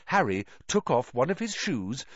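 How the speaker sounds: usual speaking voice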